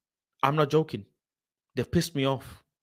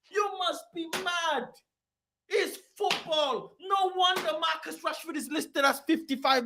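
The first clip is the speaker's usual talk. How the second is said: indignant rant; 11 semitones higher than usual. Real, not acted. Sustained high indignation.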